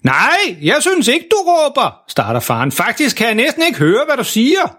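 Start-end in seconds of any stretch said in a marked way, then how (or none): 0.0-2.0 højt oppe i lejet, spillet råb; benægt det råbende; halvdøv, råbende far. Acted. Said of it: "der står og råber sådan". 2.7-4.8 højt oppe i lejet, spillet råb; benægt det råbende; halvdøv, råbende far. Acted. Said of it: "der står og råber sådan".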